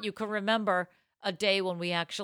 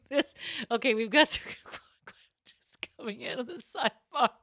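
talking through giggles, higher; laughing; 5 semitones higher than usual. Real, not acted. Host cracking up at questions coming in, laughing. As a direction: laugh your way through the words.

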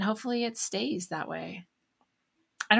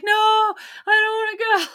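put-on much higher, louder whining kid voice; whiny kids; 14 semitones higher, 6 dB louder than usual. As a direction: mimic a whiny child refusing. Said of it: "half the class was like".

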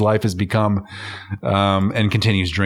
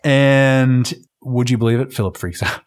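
ordinary delivery

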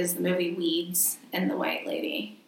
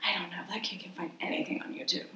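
whispered aside; 12 dB quieter than usual. Acted.

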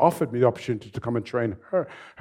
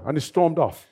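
ordinary talk, nothing marked

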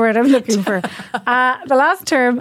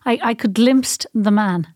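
ordinary talk

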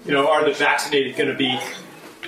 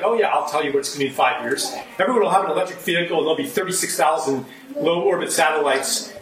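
mock Elon Musk; faster than usual. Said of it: "He'll be like".

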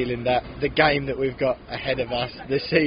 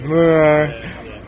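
unimpressed meh; 6 dB louder than usual. Retold or imitated. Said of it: "start every single broadcast with".

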